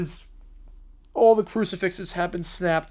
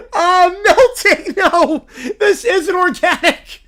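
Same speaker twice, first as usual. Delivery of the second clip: high, loud, slow laughing wail; hammed-up dying cry; a kid melting in holy water; melting child scream; 8 semitones higher, 9 dB louder, slower than usual.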